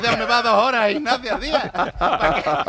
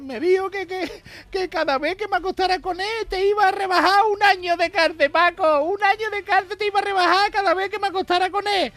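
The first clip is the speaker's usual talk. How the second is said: wailing wife; 12 semitones higher, 4 dB louder than usual. Acted. Joke wife voiced to husband.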